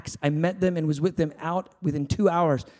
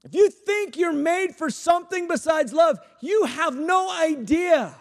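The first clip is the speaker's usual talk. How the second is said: shouted preaching; 11 semitones higher, slower than usual. Acted. Preacher shouting at the crowd about what they're made for. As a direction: hammer it home to the room.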